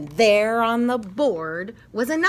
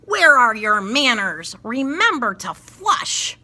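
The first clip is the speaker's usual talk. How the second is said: loud, scolding call; scolding yell; 6 dB louder than usual. Acted. Teacher yelling at the messy bathroom. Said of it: "Then I yelled with a blush".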